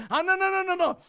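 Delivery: usual speaking voice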